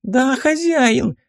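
very high, drawn-out minion voice; high-pitched obedient lackey; servile minion. Acted. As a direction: squeak an eager answer to your master. Servant answers master's summons.

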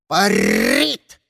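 raven's cry with a rolled r; exclaiming raven. A narrator's reading. Raven on Diana's shoulder watching the cat glide, exclaiming. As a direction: croak it out like an excited raven. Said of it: "воскликнул ворон".

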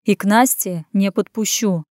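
plain reading voice

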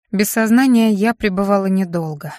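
ordinary delivery

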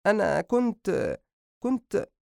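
high, slow, halting mother; nervous stalling. Acted. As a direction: hesitate as if caught out. Mother caught waiting, stalls for excuse.

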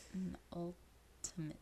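whispered, slow and drawn out. Shown as a demonstration. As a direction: breathe the word softly. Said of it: "Last but one in a series of things".

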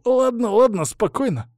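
high-pitched panicked wail; pained howl. A narrator's reading. Hostage reacting to pressure on the syringe, howling. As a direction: yelp it, pleading for calm. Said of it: "и он взвыл".

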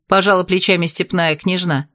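ordinary delivery

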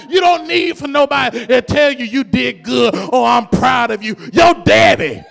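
shouted sermon climax, loud and driving; preaching at full force. Real, not acted. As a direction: call it out over the congregation.

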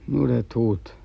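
ordinary talk

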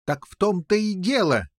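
high, mildly shouted outburst; very excited. A narrator's reading. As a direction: burst in with eager excitement. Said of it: "чрезвычайно возбудившись, закричал король Пеленор". (возбуждённо восклицая)

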